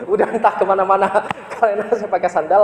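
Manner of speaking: amused at your own mishap, talk through a laugh; real chuckling through the sentence; laughing while talking. Real, not acted. Laughs at losing his own sandals.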